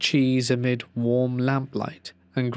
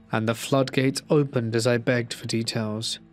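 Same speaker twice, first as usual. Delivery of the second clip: usual speaking voice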